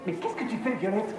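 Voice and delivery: high, startled question; shocked. Acted. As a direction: gasp it out in disbelief. Shocked by what he sees.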